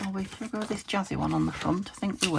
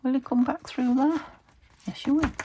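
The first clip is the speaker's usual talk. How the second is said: high, sing-song coo to a pet; 7 semitones higher, 3 dB louder than usual. Real, not acted. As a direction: coo it like talking to a puppy. Cooing to a pet.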